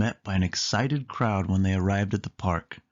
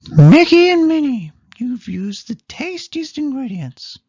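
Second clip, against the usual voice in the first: much higher, much louder announcing; Daisy voice; 11 dB louder than usual. Acted. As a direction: proclaim the winners as Daisy.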